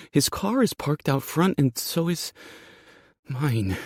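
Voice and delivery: high-pitched, worried voice; fearful. Acted. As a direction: think aloud in fear. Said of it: "fear in her eyes".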